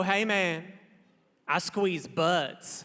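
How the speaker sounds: mock cool guy, very slow and deliberate; smug slow boast. Butt-squeezing guy boasting to the comic, slow and smug. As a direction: drag it out like a smug dude showing off. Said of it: "as if to let me know".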